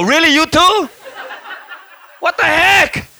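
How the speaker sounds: high-pitched mock outrage; retold incredulous squawk; indignant disbelief. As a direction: protest in wounded disbelief. Re-enacting indignation at God.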